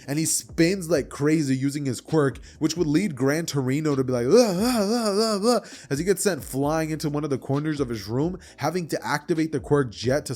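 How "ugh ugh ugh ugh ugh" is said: high, wobbling cries of being flung about; string of yelps while whirled around; repeated dizzy groans. Acted. Old man spun like crazy by his pupil, yelping groans.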